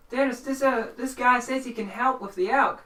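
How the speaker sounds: high, loud farmer voice; halting explanation, pitched well up; nervous country character reporting to his partner; flustered farmer. Acted. Farmer speaks to his companion.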